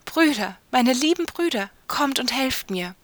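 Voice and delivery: crying out. A narrator's reading. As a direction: cry out urgently for rescue. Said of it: "Da schrie sie noch einmal".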